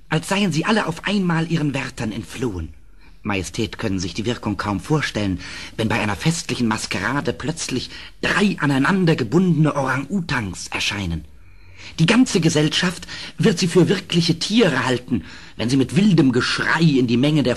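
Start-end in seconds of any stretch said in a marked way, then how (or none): none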